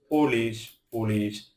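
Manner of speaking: ordinary talk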